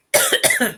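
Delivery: sharp real coughing; hard cough to clear the throat; coughing fit. Real, not acted. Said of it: "I'm choking".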